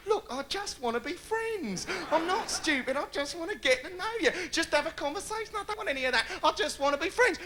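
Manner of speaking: high, soft, sweet-talking act; high soft mock-sincere come-on; fake sincere line. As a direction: coo it like an innocent chat-up line. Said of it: "use that line that men still think women believe".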